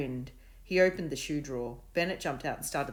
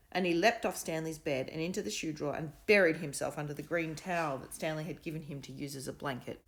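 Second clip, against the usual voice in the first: plain reading voice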